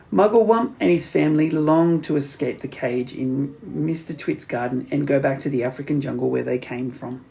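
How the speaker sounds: ordinary delivery, nothing marked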